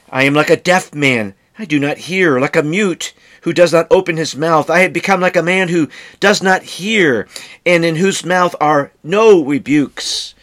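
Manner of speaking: earnest recitation of a psalm; scripture reading. Acted. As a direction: proclaim the verse with weight. Reads scripture quote aloud.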